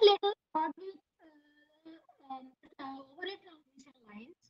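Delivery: faint, cutting out over the connection; barely audible. Real, not acted. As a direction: voice fading away on an online call. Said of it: "Not properly audible".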